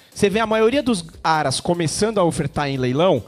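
ordinary delivery

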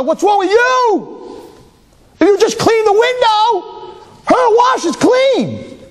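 high, slow shouted rebuke; hammed-up scolding of the wife; exasperated shout. What husband should have said.

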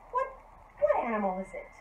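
usual speaking voice

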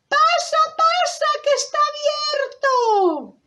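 very high, drawn-out granny voice; grandmother. Acted. Grandmother inviting the visitor in, very high slow voice. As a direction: squeal a slow welcome like a little old lady. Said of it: "Entonces dice la abuelita".

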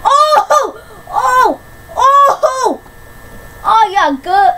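high laughing squeals of delight; excited squeals. Real, not acted. Gamer squealing as the enemy dies. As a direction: squeal with giddy joy. Cheering a lucky kill.

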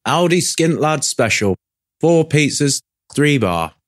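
defiant. Acted. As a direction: announce it proudly against the mockery. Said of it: "Jack defiantly announces".

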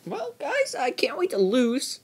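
high, squeaky sarcastic voice; mock cheer about losing. Real, not acted.

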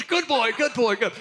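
high, loud laughing mock praise; praising the dog. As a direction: pat the dog on the head with your voice. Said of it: "he goes".

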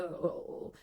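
usual speaking voice

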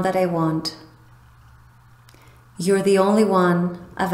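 calm, even voice with long pauses; unhurried affirmations, each left to settle; guided meditation. Acted. Meditation guide voicing a loved one's affirmations to the listener, calm and soothing. Leads visualisation and love affirmations.

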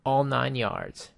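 slowly drawn out, copying the clip's line; slow, careful imitation of the sample clip; slow matching take. Shown as a demonstration. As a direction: stretch each word to match the recording. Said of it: "I can record my voice".

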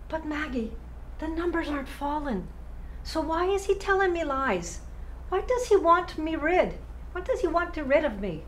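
high-pitched, agitated disbelief; distressed questioning. Acted. Acted distress over losing her job.

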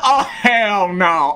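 real laughing hell no; laughing disbelief. Real, not acted. Laughing at the creeper.

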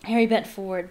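ordinary delivery, nothing marked

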